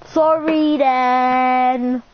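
drawn out slowly on a level pitch; grudging apology. Real, not acted. Gamer apologising under pressure to a friend, grudging. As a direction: drag out a reluctant sorry.

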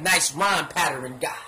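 ordinary delivery

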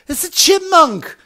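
very high squeaky mock voice, slowed; mocking the Karens fussing over a chipmunk; mocking the women. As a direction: parody the women as shrill airheads. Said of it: "And they're like".

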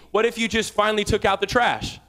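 ordinary talk, nothing marked

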